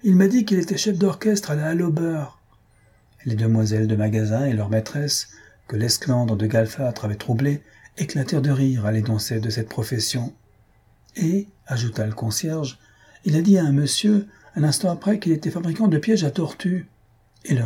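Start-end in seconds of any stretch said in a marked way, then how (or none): 0.0-2.4 high, heated accusation; indignant outcry. A narrator's reading. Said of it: "hurla Galfâtre". 11.2-11.5 high, pointed addition; indignant accusation. A narrator's reading. Concierge goes on denouncing the impostor. 13.2-16.9 high, heated accusation; indignant denunciation. A narrator's reading. Concierge goes on denouncing the impostor.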